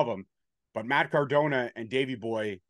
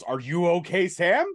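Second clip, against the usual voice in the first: loud, higher mock yell at the interviewer; 5 semitones higher, 5 dB louder than usual. Wrestler yells at the interviewer in a loud mock yell. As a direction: yell it back at her, half in jest. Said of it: "M S L turns at her and yells".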